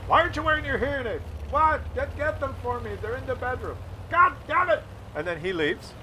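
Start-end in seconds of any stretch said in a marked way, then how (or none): 0.1-4.8 high fast mock bickering; bickering parents. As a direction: argue like old parents. Parents bickering about hearing aid.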